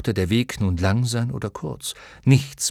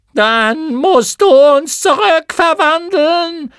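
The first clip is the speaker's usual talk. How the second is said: extremely high, loud, slow shrill demand; shrill moth voices; 11 dB louder, slower than usual. Acted. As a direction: screech it as a chorus. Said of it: "erwiderten die schrillen Stimmen". (schrille, sehr hohe Mottenstimmen)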